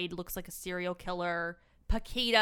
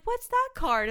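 much higher, louder questioning voice; Paquita; 6 dB louder than usual. Acted. Paquita asking the grandmother about the card, high-pitched character voice. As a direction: put on a high, loud girlish question. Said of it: "And Paquita's like".

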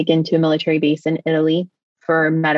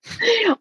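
laugh-cry. Real, not acted. Reacting to rescue by soldiers.

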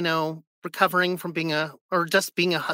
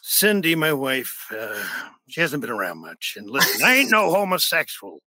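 low, halting closeted old-man impression; Wilford Brimley; 6 semitones lower than usual. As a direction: grumble a reluctant confession down low. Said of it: "closeted homosexual that hates himself".

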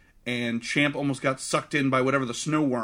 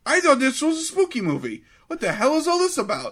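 high, louder mock disbelief; incredulous characters; 9 semitones higher, 6 dB louder, faster than usual. Host voices baffled characters in the movie, high disbelieving. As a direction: ham up baffled characters in a horror film. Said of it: "even the characters are like".

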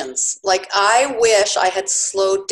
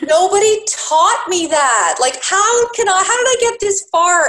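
high, loud, incredulous outburst; astonished protest; 10 semitones higher, 5 dB louder than usual. Acted. Mother answering her daughter's remark, acted astonished protest. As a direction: protest in hammed-up amazement.

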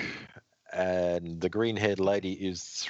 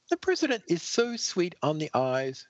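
swooning voice; 10 semitones higher than usual. Acted.

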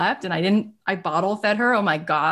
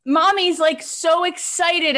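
excited; 9 semitones higher than usual. Retold or imitated. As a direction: gush about the kids being in school. Continues excited self-quote.

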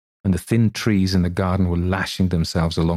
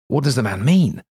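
irritated; 5 semitones higher than usual. A narrator's reading. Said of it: "rather inclined to be irritated".

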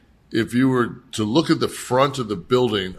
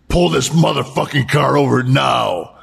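sharp retold shout, a bit higher; Scott's shout; 4 semitones higher than usual. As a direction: bark the order to pull over. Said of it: "And Scott says".

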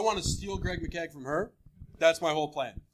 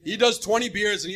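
ordinary talk

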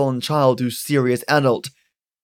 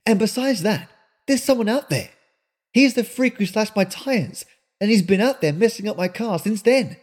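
put-on higher, pressing young woman's voice; indignant young woman; 5 semitones higher than usual. Melinda presses Granny about the roadhouse, indignant and higher. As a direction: demand answers, rattled and suspicious. Melinda lists her grievances.

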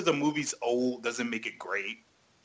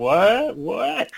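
confused imitation; 6 semitones higher, 7 dB louder than usual. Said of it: "Mark's like".

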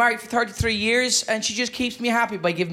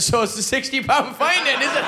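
higher, taunting question; cheeky mocking echo; 5 semitones higher than usual. Cyclist winding up the policeman over a fine, mocking. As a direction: throw it back at the officer, pitched up.

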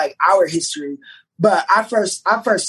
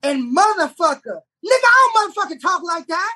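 high squeaky mock old lady, indignant; hammed-up high voice of Pat complaining about the script's cursing; mock Miss Pat; 11 semitones higher than usual. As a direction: squeal it like an outraged older woman fussing about cussing. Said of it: "She said".

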